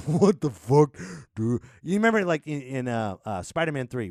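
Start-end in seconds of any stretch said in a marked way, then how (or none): 0.1-1.6 loud laughing blurt; loud laughing disbelief; laughing outburst. Retold or imitated. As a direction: burst out through a laugh, slow and loud. Freaking out at sand hand.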